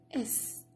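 "S" said as a soft voiceless sound; double S as one S. Shown as a demonstration. Teacher showing how double S is pronounced, soft single "S" sound. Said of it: "We pronounce it".

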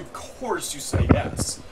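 ordinary talk, nothing marked

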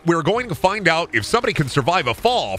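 high, emphatic YouTuber challenge intro; hammed-up host pitch, swinging high; Mister Beast. YouTuber announcing a deadly challenge, in a high hyped voice. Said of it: "I love Mister Beast video".

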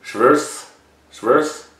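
"Schverse" as one syllable, SHVURSE; unsure pronunciation. Real, not acted. Reactor sounding out the spelled name, unsure pronunciation. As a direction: try "Schverse" as SHVURSE, each time a rising question. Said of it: "how to say this guy's name".

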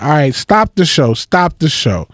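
host stops the show to announce a new regular, loud; announcing loudly. Retold or imitated. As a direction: proclaim it to the whole room. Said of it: "He's like".

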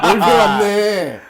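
slow, laughing remark. Real, not acted. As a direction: chuckle through the words. Laughing at unanimous vote.

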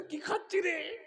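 high, soft mimicking voice; light high-pitched imitation; mocking mimicry.

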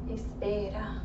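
ordinary talk, nothing marked

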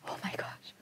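hushed, breath-only aside; shocked whisper. Pretend. Teacher reacting to the eagle's attack, shocked whisper.

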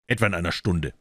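plain reading voice

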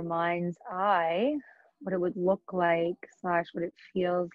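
unhurried yoga nidra guidance with long pauses; guided meditation. Acted. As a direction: guide them into relaxation, leaving space between phrases. Leads a yoga nidra practice.

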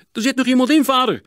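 high, loud call to his father; urgent boy's call. A narrator's reading. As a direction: shout the alarm to father. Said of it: "riep Sietse".

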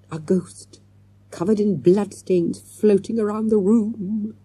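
spooky quaver, drawn-out and wobbling; mock a ghost with a slow, quavering wail. Boy teasing about a floating ghost, spooky quaver. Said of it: "in a quavering voice".

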